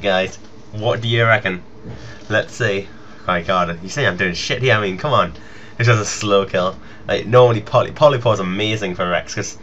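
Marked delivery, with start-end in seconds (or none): none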